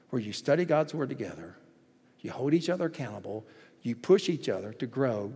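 ordinary talk, nothing marked

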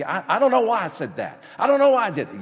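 loud, high protesting voice; defensive excuse. Acted. Churchgoer excusing his temper to the preacher, defensive. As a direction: blurt it out in mock helpless protest.

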